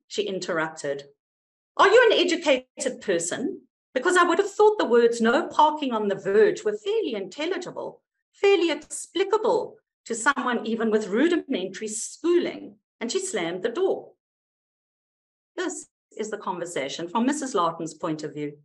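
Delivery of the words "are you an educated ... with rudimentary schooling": indignant scolding. Retold or imitated. Rude neighbour scolding at door.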